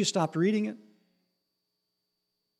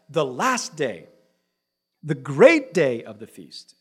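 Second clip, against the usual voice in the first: slow, solemn verse reading; measured scripture reading; 3 semitones lower, 5 dB louder, slower than usual. Acted. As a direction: read it out slowly.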